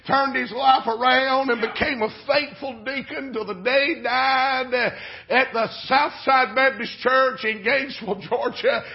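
rhythmic sermon crescendo; full-force preaching. Real, not acted. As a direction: preach it with mounting urgency. Preaches the application, building.

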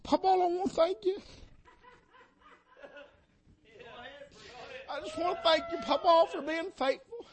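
holding back tears while thanking grandpa; choked up. Real, not acted. Retelling his thanks to grandfather, choked up.

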